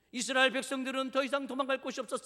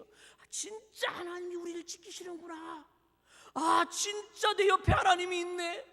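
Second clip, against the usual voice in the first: discover it, then cry it out; awed realization; 8 semitones higher, 4 dB louder than usual. Acted. Israelites realising God is beside them, amazed exclamation.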